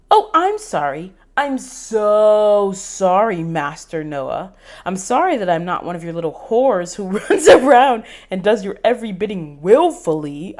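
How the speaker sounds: loud, mocking fake apology; loud sarcastic anger; sarcastic outburst. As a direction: spit the fake apology at him. Angry sarcastic outburst at him.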